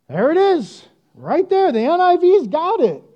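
high, loud triumphant pointing out; there it is, found it; triumphant reveal of the verse; excited. Real, not acted.

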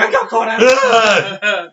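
loud drawn-out mock wail; mock distressed caller. Podcaster mimics an upset caller phoning afterwards, mock wailing. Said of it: "calling us up afterwards going".

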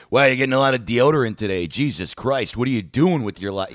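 loud, fast mock heckle; aggressive shit talk. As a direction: rib a shopper like an aggressive cashier. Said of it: "tonally so aggressive".

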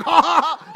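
high, loud "ha ha ha"; imitated laugh. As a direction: mimic a big delighted laugh. Acts out the man's laughing reply.

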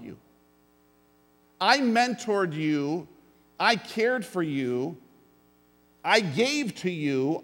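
rally the congregation, hammering every point; preaching at full force. Acted.

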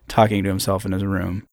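ordinary delivery

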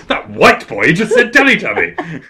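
high, loud, drawn-out mock bafflement; baffled outburst. Retelling the woman MP's reaction, baffled shouting. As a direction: imitate a stunned MP calling it out. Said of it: "just stands up in just complete bafflement".

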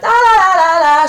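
high, loud la-la-la chant; drowning out thoughts. Shown as a demonstration. Drowning out horrible thoughts, loud and high. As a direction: blot it out with noisy babble.

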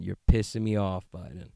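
usual speaking voice

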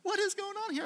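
high startled question; mock alarmed disbelief; baffled. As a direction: mimic bewildered Baptist friends. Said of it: "So they're like".

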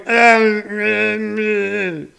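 mock incoherent moan, dying away; drunken party groan. Comedian imitating backwoods party talk three hours in, drunken groan. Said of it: "main topic of conversation about three hours of the party".

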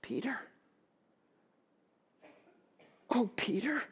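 very soft, gentle address; tender, sorrowful. Acted. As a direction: say the name softly, with grace. Voicing Jesus's grace toward Peter.

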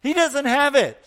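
rising to a loud, high shout on the last words; wife shouting the news out of the window; shouted announcement. Acted. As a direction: call it out across to the neighbour, building. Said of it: "The wife said".

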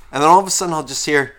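normal talking voice

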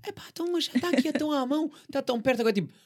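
high, loud indignant rant; exasperated outburst. Acted. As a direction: protest as if leaving it is absurd. Acts out her indignant fire logic. (desabafo indignado)

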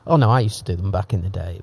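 ordinary talk, nothing marked

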